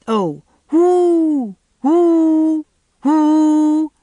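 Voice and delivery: high, loud, long drawn hoots; echo calls. A narrator's reading. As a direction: call out over the river to wake the echoes.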